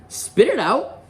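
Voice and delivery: high-pitched prompting; Ron urging. Acted. Boy prompting a silent girl to speak, high urging voice. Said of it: "said Ron, watching her".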